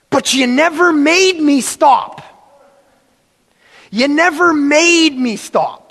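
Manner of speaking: put-on slow, whining child's excuse; whiny child arguing. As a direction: argue like a stubborn kid. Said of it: "I answered like this".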